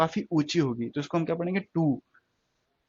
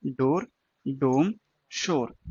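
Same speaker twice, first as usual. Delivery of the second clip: slowly listed, डोर then डोम; careful slow word list; slow recap of words; 2 semitones lower, much slower than usual. Shown as a demonstration. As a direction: read each word out slowly with gaps. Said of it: "तीन word कर चुके हैं".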